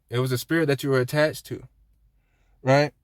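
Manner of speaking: usual speaking voice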